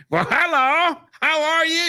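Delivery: high ventriloquist dummy voice; puppet voice. Retold or imitated. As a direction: greet like a chirpy puppet. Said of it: "he was making them".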